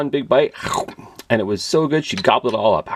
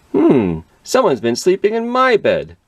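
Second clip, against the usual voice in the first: higher mama bear voice; 11 semitones higher, 3 dB louder than usual. A narrator's reading. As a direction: ask it like a puzzled mother bear.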